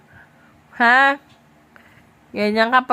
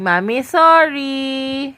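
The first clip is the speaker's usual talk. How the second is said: very slow, drawn-out apology for copying; modelled apology; much slower than usual. Shown as a demonstration. Parent coaching child to apologize, slow and drawn-out. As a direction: stretch each word for a child to repeat.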